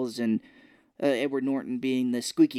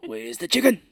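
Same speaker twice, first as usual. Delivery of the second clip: louder, higher put-on elderly voice; old man voice; 5 semitones higher, 6 dB louder than usual. Host demonstrates his old man accent, loud elderly demand. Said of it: "that's my old man accent".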